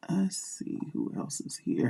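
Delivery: whispered aside to herself. Real, not acted. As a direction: breathe it under your breath. Scanning the chat aside.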